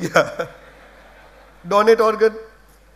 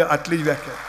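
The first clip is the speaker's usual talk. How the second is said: ordinary talk, nothing marked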